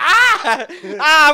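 very high, loud mock shrieks of pain; retold pain scream. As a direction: shriek as the knee pops out. Retelling knee dislocating.

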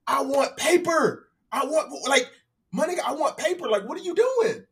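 hammed-up tourist rant; going off. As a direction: insist on paper money, exasperated. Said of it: "going off on this dude".

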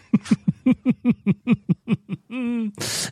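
long high giggling laugh; high-pitched laugh. Real, not acted. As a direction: burst into a squealing laugh. Big laugh after voicemail story.

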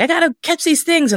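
high-pitched mock-urgent eagerness; excited. Teller mimicking herself running off to catch Pokemon, excited. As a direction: squeal it like an eager player dashing off. Acting out her gleeful dash to play.

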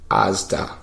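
lightly voiced old man; a hint of an aged prank caller; old guy. Old-man prank caller answering the taxi operator, lightly aged voice. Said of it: "pretend to be, like, an old guy".

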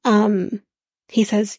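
usual speaking voice

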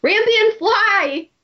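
high hammed-up command; commanding Thorn. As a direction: yell at the ship to take off.

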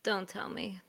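unvoiced gossipy whisper; a conspiratorial whisper to a coworker. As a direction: hiss it under your breath. Gossiping about the newcomer.

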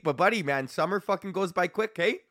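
normal talking voice

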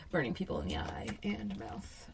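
sly devilish whisper. Pretend. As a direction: whisper it wickedly. Devilish joke.

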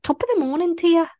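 high, quick, hammed-up Irish accent; mock Irish accent. Shown as a demonstration. Co-host asking what Irish sounds like, mock Irish accent. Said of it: "What is an Irish accent?".

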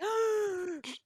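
sharp, high mock gasp; theatrical shocked inhale; collective gasp. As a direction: imitate a courtroom sucking in its breath. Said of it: "there was a collective gasp in the courtroom".

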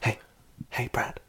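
barely voiced, soft greeting; hushed approach. About to tell Brad bad news, hushed. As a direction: softly get his attention. Restarts the acted gentle confrontation.

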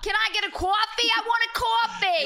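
high, loud, hammed-up New York; New York accent. Ordering coffee, loud mock New York accent. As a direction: demand it in broad New York. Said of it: "I was in New York".

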